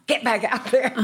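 urging shout. Retold or imitated. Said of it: "And we're going".

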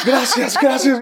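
high, loud, giddy thank-yous through a laugh; bubbling over with relieved laughter. Retold or imitated. Relieved thanks after being passed.

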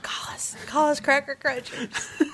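breathy ad tagline whisper. Pretend. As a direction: whisper the slogan close to the mic.

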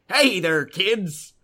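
lightly voiced cheery old man; a hint of a folksy old-timer greeting. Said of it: "some old guy riding a go-goat, like".

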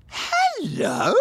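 high, drawn-out questioning hello; imitating toddler. Dad retelling his toddler seeing him in the morning, imitating toddler. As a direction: mimic a small child's high-pitched greeting. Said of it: "she just looked at me and she went".

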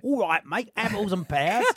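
hammed-up Cockney geezer accent; mock Cockney accent. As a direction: lay on a matey Cockney accent. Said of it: "like me sitting there going".

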